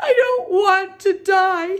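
high, loud, drawn-out wail; tearful moan. A narrator's reading. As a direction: wail it out slowly in tears. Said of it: "he moaned".

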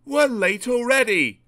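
high, loud, impatient complaint; grumbling. A narrator's reading. Passengers late because of the argument, grumbling. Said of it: "grumbled the passengers".

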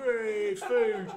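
put-on slow, loud drunk delight; mock drunk. Drunk bloke grabbing spat food, slow and loud. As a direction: drawl it out like a pisshead.

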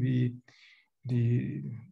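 ordinary talk

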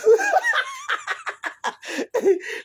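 high shrieking laugh. Real, not acted. Husband flees.

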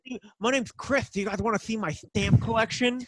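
high, eager nerd showing off; nerdy voice. Pretend. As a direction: play the awkward collector kid. Mocking himself as a nerd.

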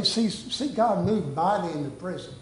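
ordinary delivery

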